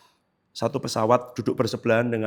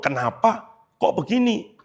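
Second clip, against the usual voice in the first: puzzled lament; 8 semitones higher, slower than usual. Retold or imitated. Inner struggle, wrestling with God.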